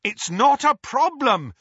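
high, loud shout to the driver; shouted back. A narrator's reading. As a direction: shout it urgently, stretching the last words. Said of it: "Harry shouted back".

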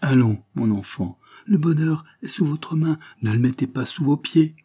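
impassioned, earnest urging; ardent warmth. A narrator's reading. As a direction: plead your love with heat. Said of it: "continua l'avoué avec chaleur".

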